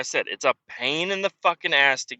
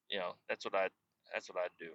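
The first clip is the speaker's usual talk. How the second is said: ordinary talk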